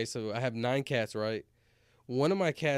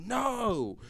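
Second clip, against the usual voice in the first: anguished no; 11 semitones higher than usual. Retold or imitated. Retold anguish hitting possum.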